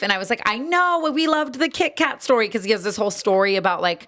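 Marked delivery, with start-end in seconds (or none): 0.5-2.5 retold gushing, pitched high; re-enacted starstruck enthusiasm; excited fan. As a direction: gush to a star's wife, high-voiced. Retold fan meeting his wife.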